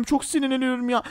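ordinary talk, nothing marked

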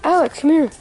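calling out. Real, not acted. Calling friend across store.

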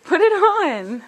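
pet coaxing. Real, not acted. Owner coaxing her cat to wear a tiny hat, high excited pet voice.

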